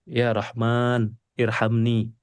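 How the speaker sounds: low, slow murmured prayer; prayer in prostration. Shown as a demonstration. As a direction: murmur the plea slowly, head bowed. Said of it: "Habis itu sujud lagi".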